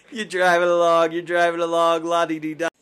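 lightly sung-along carefree driving. Retold or imitated. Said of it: "his complete breakdown of the".

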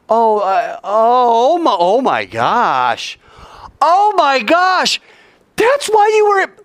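stunned gasps rising to high, loud cries; amazed realization. Acted. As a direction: act out a sudden dawning revelation. Disciples suddenly get the psalm.

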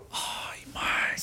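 whisper. Retold or imitated. Asombro contado de todos.

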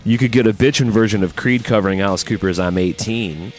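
ordinary talk, nothing marked

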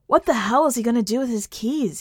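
usual speaking voice

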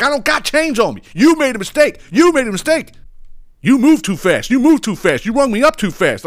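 very high, loud mock tirade; retold screaming customer. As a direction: imitate an outraged customer yelling his accusations. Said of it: "he's actually screaming about it".

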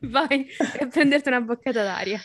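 amused, laughing as the words come out. Real, not acted. Laughing at co-host's antics.

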